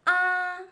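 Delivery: "A" held high, level and loud; "A" as a long, even high tone; first tone. Shown as a demonstration. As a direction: hold "A" on one high pitch. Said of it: "four different tones in Chinese pinyin".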